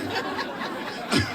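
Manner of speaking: soft unvoiced laugh; breathy laugh. Real, not acted. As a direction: laugh quietly at yourself. Laughing at own bad French.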